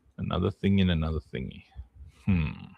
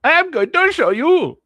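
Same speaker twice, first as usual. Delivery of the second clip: much higher, louder mock-grand announcement; hammed-up announcement; 8 dB louder than usual. Streamer promises chat goodies in a loud mock-grand voice. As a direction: announce it like a ringmaster.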